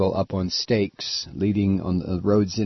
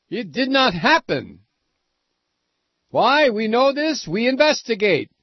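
mock Romanian official; 12 semitones higher than usual. Host keeps mocking the Romanian official in a hammed-up high accent.

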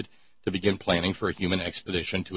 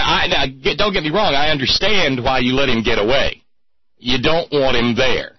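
higher and louder, a hammed-up rant; mock outrage; 4 semitones higher, 6 dB louder than usual. Host scolds Australian listeners over Ken Ham, hammed-up loud rant. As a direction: pile the blame on, playfully indignant.

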